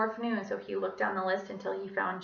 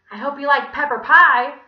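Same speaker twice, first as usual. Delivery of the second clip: high, loud lady; triumphant Miss Mush; 6 semitones higher, 17 dB louder than usual. A narrator's reading. Visitor about to shove pie at villain, triumphant. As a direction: deliver it with punchy relish.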